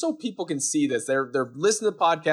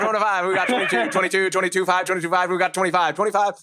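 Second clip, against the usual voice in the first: rapid-fire auctioneer calling out bids; 4 semitones higher than usual. Pretend. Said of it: "Starting to sound like an auctioneer".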